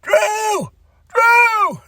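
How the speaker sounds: high shout calling Drew; yelling for Drew. Retold or imitated. Cody shouting for Drew backstage, high and loud. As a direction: yell the name high and loud, searching. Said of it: "He's like".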